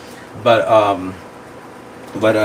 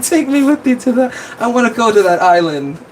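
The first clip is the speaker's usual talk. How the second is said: high-pitched, pleading imitation of the heroine; mock heroine plea; 11 semitones higher, 3 dB louder than usual. Streamer mimics the movie heroine begging to go along, high-pitched mock plea. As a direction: beg to come along in a much higher put-on voice. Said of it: "when she's like".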